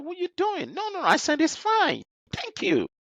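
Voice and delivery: high mock voice brushing it off; boss refusing help. Boss telling junior it's fine, pitched high. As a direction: imitate the boss waving you away.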